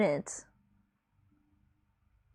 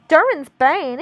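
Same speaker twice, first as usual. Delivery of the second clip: higher, louder cry of dread; 5 semitones higher, 7 dB louder, slower than usual. A narrator's reading. Gimli naming Durin's Bane, hammed-up cry. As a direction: cry it out in dumb blonde voice. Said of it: "he cried".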